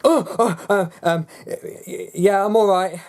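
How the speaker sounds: high, loud and caught off guard; flustered stammer. Acted. Caught by mum upstairs, stammering a high panicked reply.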